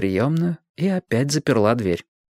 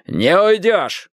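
high, drawn-out angry bark; commanding yell; 8 semitones higher, 3 dB louder, much slower than usual. A narrator's reading. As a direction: shout it to stop someone fleeing. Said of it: "гаркнул Страйк".

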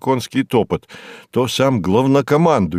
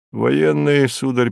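plain reading voice

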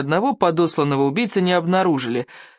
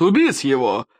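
mild mock yell; storybook furious order, only slightly raised; yelled command; 2 semitones lower than usual. A narrator's reading. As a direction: order it with a sudden swoop of pitch. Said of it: "заорал он".

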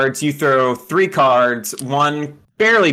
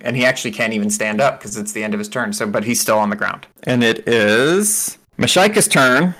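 normal talking voice

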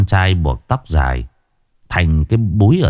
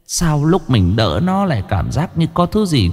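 put-on old woman muttering; 4 semitones higher than usual. A narrator's reading. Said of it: "lẩm bẩm".

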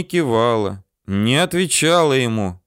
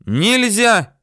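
put-on high, forceful girl's protest; high narrated shout of a girl; sharp cry; 11 semitones higher, 4 dB louder, much slower than usual. A narrator's reading. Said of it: "вдруг резко крикнула девочка".

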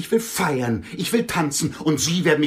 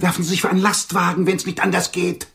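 angry outburst; 6 semitones higher, 5 dB louder than usual. Acted. Heated quarrel, telling him to die.

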